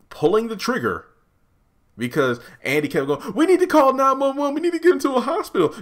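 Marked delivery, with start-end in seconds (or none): 3.3-5.7 very high, loud, rushing; panicked pleading. Retold or imitated. Andy pleading to save Gary, panicked. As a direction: plead urgently for help.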